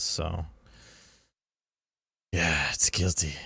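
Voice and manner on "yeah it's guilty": breathy Minnesota-flavored whisper of guilty. Real, not acted. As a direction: whisper it, then say it aloud. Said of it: "whispered take then plain repeat".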